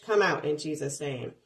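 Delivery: usual speaking voice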